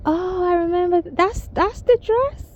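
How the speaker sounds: a husband who suddenly recognises the dress; husband's surprised reply. Retold or imitated. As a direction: play the husband catching on, puzzled. Husband voiced in retold story.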